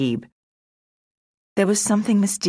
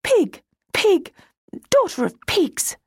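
put-on high, angry childish insult; angry child muttering. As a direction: spit the insults like a furious little girl. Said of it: "growing more and more angry and muttering".

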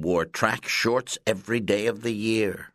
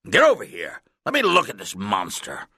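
loud commands, the first one highest; shouted orders; 6 dB louder than usual. A narrator's reading. Coach ordering the dizzy boy over to him, loud shouting. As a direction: bark the orders so he obeys at once. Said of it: "Coach shouted".

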